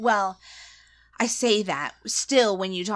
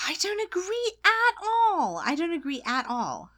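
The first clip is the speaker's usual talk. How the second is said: mock protest; 11 semitones higher than usual. Mock indignant denial.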